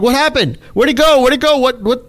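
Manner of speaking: high retold panic; frantic searching for the lost trade; panicked. New trader finding the yen trade gone, high frantic questions. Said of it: "I'm like".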